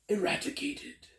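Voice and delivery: high, theatrical emphasis on one word; dramatic. Pretend. Tarot reader exaggerating what the Tower card does, dramatic. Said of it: "I'm being dramatic with the word".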